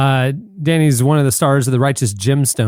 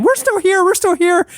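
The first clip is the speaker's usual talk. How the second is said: much higher, small insistent voice; Netflix pleading. Retold or imitated. Netflix begging for attention, very high. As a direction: squeak it out, desperate for notice. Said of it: "Netflix going".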